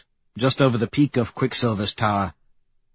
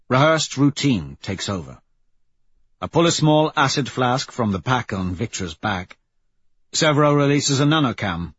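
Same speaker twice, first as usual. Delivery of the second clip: ordinary delivery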